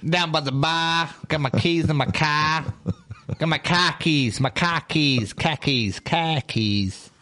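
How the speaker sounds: a hint of a Boston accent, r's dropped in "car keys"; mock Boston accent. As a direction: lightly play a Boston accent, tongue-in-cheek. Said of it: "like you're from Connecticut".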